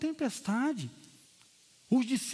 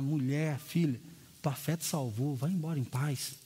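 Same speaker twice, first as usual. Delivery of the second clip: tender Jesus; 8 semitones lower than usual. Acted. Jesus speaks to the woman. (Jesus terno, voz baixa e suave)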